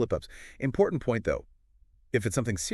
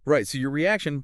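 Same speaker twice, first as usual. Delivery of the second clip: normal talking voice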